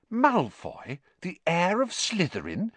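high, doubting query; sceptical. A narrator's reading. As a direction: repeat the idea as if it can't be true. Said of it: "said Hermione sceptically".

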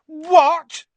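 a sudden outraged shout; yelled. A narrator's reading. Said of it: "Harry yelled".

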